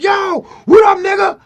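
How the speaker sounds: very high, loud phone hello; imitated shouted greeting. Rapper greeting his friend by phone, imitated hearty shout. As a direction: boom out an excited greeting, imitating a caller.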